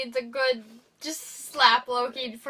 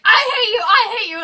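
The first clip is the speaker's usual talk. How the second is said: much higher, louder shouted "I hate you"; mock hateful yell; 9 semitones higher, 11 dB louder than usual. Warrior yelling at a foe before a hug, mock furious shout. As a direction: scream it at your rival mid-battle. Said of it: "imagine someone being like".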